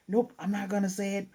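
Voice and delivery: high-pitched, lightly mocked defiance; stubborn refusal. [name] refusing to admit his feelings, lightly acted stubbornness. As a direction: imitate a kid digging in.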